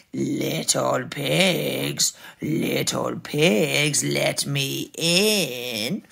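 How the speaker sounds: low, very slow wolf call; sly, lingering villain voice; big bad wolf. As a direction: draw it out low and menacing. Wolf calls to pigs.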